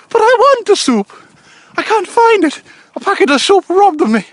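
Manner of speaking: very high, loud mock Irish voice; hammed-up high-pitched Irish accent; Irish guy impression. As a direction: squeal a complaint as an Irish caricature. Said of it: "the Irish guy".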